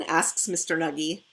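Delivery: ordinary delivery, nothing marked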